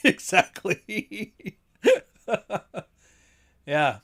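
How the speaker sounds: high, drawn-out word breaking into laughter; squealed out laughing. Real, not acted. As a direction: laugh right through the word, stretching it out. Laughing at own joke.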